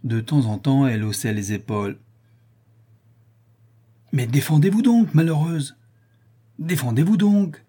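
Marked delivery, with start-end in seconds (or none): 4.1-7.6 loud, high jealous outburst; jealous lover yelling at her; shouting. Acted. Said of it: "criait le monsieur".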